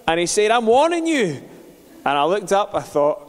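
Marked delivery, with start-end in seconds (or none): 0.5-1.4 high, loud warning; threat. Retold or imitated. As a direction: shout a threat down from the window. Neighbour threatens from window.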